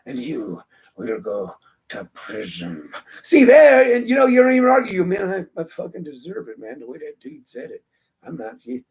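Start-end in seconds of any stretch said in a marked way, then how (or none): 0.0-2.9 low, hushed, slowing to a creaky whisper; menacing old judge. Acted. Ugly old judge sending someone to prison, low whispered menace. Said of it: "Someone who looks like me". 5.0-8.9 low, very soft resigned admission; cowed convict. Acted. Convict awed by how the judge said it, low hushed acceptance.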